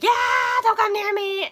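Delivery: loud scared cry; panicked shout. Retold or imitated. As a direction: shriek it as he backs away in terror. Character panics at fishmen.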